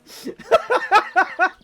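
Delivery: loud high burst of laughter. Real, not acted. Streamer cracking up at garbled chat message, loud laughter.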